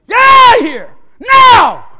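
high, loud get out; yelling command; shouted order. Retold or imitated. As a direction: drive the family out of the room. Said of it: "I told my mother and my sister".

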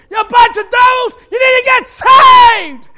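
high, loud, slow denunciation; fiery preaching shout; Gil's shouted rebuke. Retold or imitated. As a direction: thunder back at the neighbors. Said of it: "And Gil says".

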